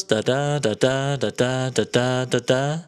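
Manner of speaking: slow da-DUM da-DUM beat, stressing every second da; sing-song iambic pentameter demo; iambic rhythm. Shown as a demonstration. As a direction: tap out the iambic pattern. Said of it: "which looks like this".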